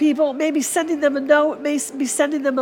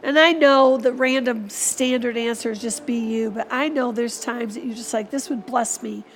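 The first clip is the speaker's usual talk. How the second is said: soft, fading prayer to the Father; closing prayer; 4 dB quieter than usual. Acted. Pastor closing the Christmas sermon in quiet prayer. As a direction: pray quietly, tender and earnest.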